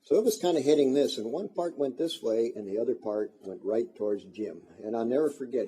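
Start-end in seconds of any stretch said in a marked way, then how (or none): none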